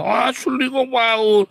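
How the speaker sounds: high, loud mock protest; insistent pastor. Pastor asked if he is genuine, exaggerated insistence. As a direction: insist indignantly to the counsellor.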